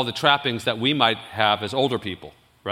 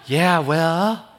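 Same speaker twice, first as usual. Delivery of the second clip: a light imitation of a teen preacher, a bit higher; Kevin's way of talking; 3 semitones higher than usual. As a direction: lightly mimic a shy teenage guest speaker. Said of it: "That's how he talked".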